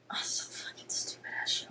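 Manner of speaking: soft, hushed whisper; whispered aside. Real, not acted. Whispering to viewers about the boy, hushed. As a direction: mutter it under your breath.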